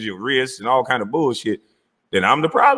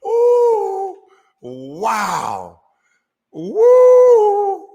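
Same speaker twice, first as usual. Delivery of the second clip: loud high whooping in disbelief; astonished whoop; amazed whoop; 5 dB louder, much slower than usual. Real, not acted. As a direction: holler like you can't believe it. Commentator reacting in disbelief.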